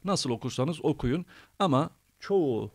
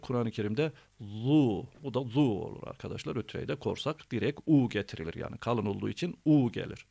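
ordinary talk

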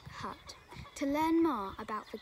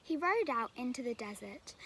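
usual speaking voice

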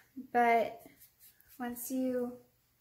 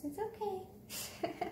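soothing murmur; 7 semitones higher, 4 dB quieter, much slower than usual. Real, not acted. Calming the dog again.

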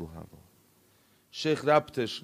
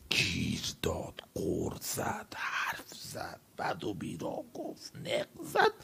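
hushed retelling; 6 semitones lower, 11 dB quieter, slower than usual. Retold or imitated. Retelling mother's nagging at night.

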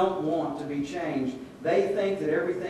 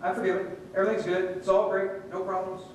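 breezy, offhand brush-off; flippant; 4 semitones higher than usual. Acted. Believer casually using God's forgiveness, flippant. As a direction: toss it off lightly. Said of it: "just flippantly just use and go".

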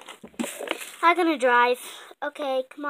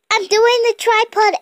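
higher, loud straining push; straining effort; 8 semitones higher, 11 dB louder than usual. Acted. Straining at handstand.

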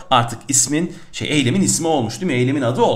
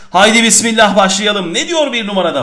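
energized start; 6 semitones higher, 6 dB louder than usual. Real, not acted. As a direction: kick off the lesson with fresh gusto. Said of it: "Sesimiz gerçekten açıldı".

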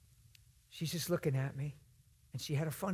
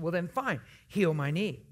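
spiteful; 7 dB louder than usual. Retold or imitated. Sarcastic woman daring the preacher to heal her knee, loud and spiteful. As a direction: fling the challenge at him with scorn. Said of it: "she's being really spiteful".

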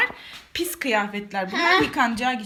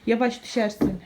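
ordinary delivery

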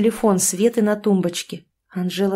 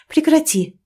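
higher, louder firm stop; cutting her off; 8 semitones higher, 4 dB louder than usual. A narrator's reading. Polina stopping her friend's apology, firm and raised. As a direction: shut the apology down at once. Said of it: "пресекла её подруга".

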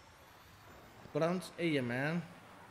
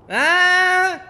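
excited yell; 13 semitones higher, 14 dB louder than usual. Real, not acted. Kill in game.

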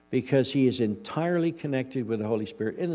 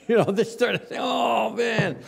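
exasperated shout; 11 semitones higher, 6 dB louder than usual. Real, not acted. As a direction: pitch jumps up, loud groan. Exasperated outburst over taxes.

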